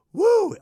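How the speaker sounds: high, loud wrestler's woo yell; Ric Flair woo. Retold or imitated. Podcaster imitating Ric Flair, a loud high woo.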